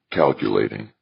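plain reading voice

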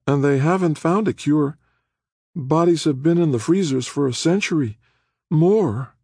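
put-on high-pitched, disgusted young woman. A narrator's reading. Prisoner remarking on the freezers, in a disgusted tone. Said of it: "said in a disgusted tone".

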